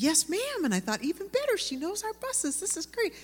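hopeful delight at a possible visitor; eager and happy. Retold or imitated. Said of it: "I'm all happy and excited about it".

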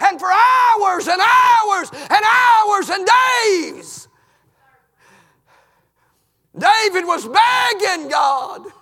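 loud, high, slow anguished preaching; preacher crying out a confession; fervent preaching. Acted. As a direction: pour out the guilt from the pulpit. Preaching at full force.

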